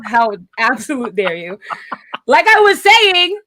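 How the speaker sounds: mock outrage dissolving into laughter; laughing. Real, not acted. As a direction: laugh through the fake indignation. Laughing off friend's teasing.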